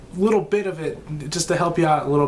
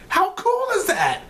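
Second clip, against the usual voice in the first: much higher, thrilled squeal; more excited. Real, not acted. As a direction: push the pitch way up on cool.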